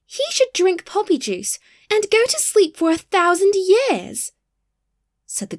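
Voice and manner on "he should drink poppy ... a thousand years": high, loud indignant outburst; angry flower pronouncing a sentence; hot and angry. A narrator's reading. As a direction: pitch it high with rising outrage. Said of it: "they grew quite hot and angry".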